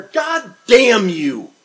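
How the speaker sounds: drawn-out shouted oath; furious curse. Retold or imitated. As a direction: hurl it like a cold glass of water in the face.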